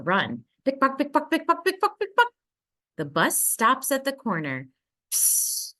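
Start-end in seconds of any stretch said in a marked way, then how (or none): 0.6-2.3 high, bouncy pickpock like little heels; clicking heels sound. A narrator's reading. Reading to a child, mimicking the lady's heels. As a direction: tap it out higher and higher.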